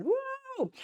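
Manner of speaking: very high woo; cheer. Real, not acted. Vlogger celebrating a member's weight loss, high whoop. Cheering a hundred pounds lost.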